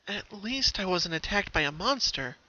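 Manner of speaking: hurting pony mutters to herself, groaning; groaning Pinky. A narrator's reading. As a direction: groan it out, sore and tired. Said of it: "she groaned".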